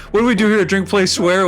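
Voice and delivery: playful announcer voice naming the show; show intro call. Pretend. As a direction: hype up the show's title like a TV host. Repeats the show intro louder.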